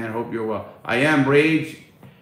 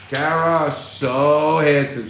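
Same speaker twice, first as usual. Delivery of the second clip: slow, higher, drawn-out swooning praise; mock Kara compliment; 4 semitones higher, 7 dB louder, much slower than usual. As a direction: coo it like Kara flattering him. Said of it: "she said".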